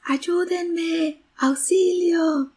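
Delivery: high, drawn-out echoed call; echo of child's cry. A narrator's reading. Mountain echo answers the boy, high long-held call for help. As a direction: repeat the cry high, holding the vowels. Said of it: "le respondió una voz".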